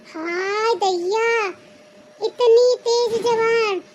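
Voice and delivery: very slow, drawn-out dismay; aghast, long-drawn exclamation; scandalised. Acted. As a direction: stretch each word in theatrical shock. Sister-in-law scandalised by back talk.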